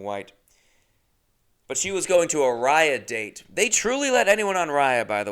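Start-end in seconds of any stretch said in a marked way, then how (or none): none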